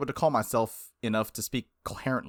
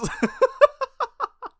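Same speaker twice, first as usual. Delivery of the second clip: much higher, louder real laugh; 7 dB louder than usual. Real, not acted. Laughing at his reference.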